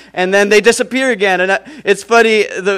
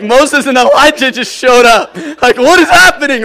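mock panic, higher and louder; freaked out; 4 semitones higher, 5 dB louder than usual. Peter panicking as Moses and Elijah appear, mock panic. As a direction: play Peter losing it. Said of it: "He's so freaked out".

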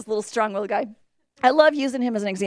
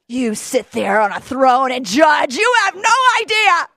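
mock angry shout; 7 dB louder than usual. Mother shouting back at critics on their throne. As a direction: hurl it back at the judges. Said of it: "And I'm like".

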